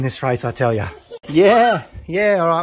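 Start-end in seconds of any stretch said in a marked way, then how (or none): 1.3-2.6 higher, loud whooping yeah; loud cheer. Real, not acted. Fancier whooping after his bird clocks, loud.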